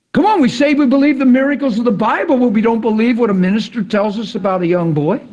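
fiery preacher's plea; preaching at full force. Real, not acted. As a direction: challenge the crowd, pushing hard. Preacher challenging unbelief.

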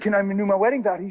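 quick, spontaneous ask; blurted out. Retold or imitated. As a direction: blurt it fast without planning.

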